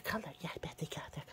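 soft whisper. Real, not acted. Owner whispering over sleeping pups, soft whisper. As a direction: whisper so as not to disturb.

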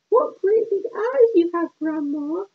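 put-on high, wide-eyed little girl. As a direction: squeak it like an innocent child. Girl questions disguised wolf.

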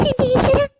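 high cry to God in dread; horrified. Acted. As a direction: gasp out a prayer in alarm. Horrified at news of the accident. (പേടിയോടെ)

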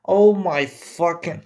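ordinary talk, nothing marked

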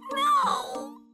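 high, sharp refusal; shrill no. Acted. Rose refuses again.

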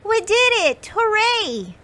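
high, loud triumphant mouse cheer. Acted. Molly cheers loudly in triumph with her friends. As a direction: cheer in triumph at the finished snowman. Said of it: "Molly cheered".

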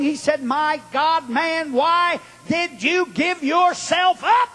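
high, slow, building revival delivery; dramatic preaching. Real, not acted. Preacher retelling the attorney's interrogation, building intensity. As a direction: build steadily to an incredulous cry.

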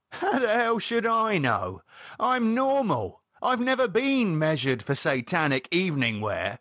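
high, exasperated protest; David indignant. Acted. David asked by Lydia for his robe size, indignant. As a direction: scoff at the very idea.

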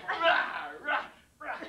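high mock kicking grunts; acted bursts of exertion; effort yells while kicking. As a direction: punch out separate yells, one per kick. Acts out kicking the dog.

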